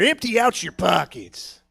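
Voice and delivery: slow, drawn-out mock robber voice; robber's demand. Unseen robber to a passer-by, slow mock demand. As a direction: stretch out the demand at talking volume.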